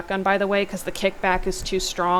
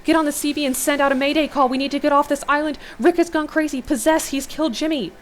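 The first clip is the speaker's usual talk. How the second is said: urgent radio shout; 8 semitones higher, 5 dB louder than usual. Acted. Urgent radio call for help.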